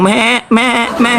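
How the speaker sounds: a light mock bleat on each "me"; sheep bleat. Preacher mimics a sheep at the heavenly wedding, mock bleat. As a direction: playfully mimic a sheep's baa. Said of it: "Kan domba nanti ngomong".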